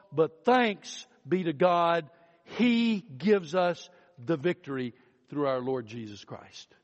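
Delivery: lifted, rhythmic sermon cadence; preaching. Acted. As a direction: preach the promise with conviction. Preaching on resurrection and scripture.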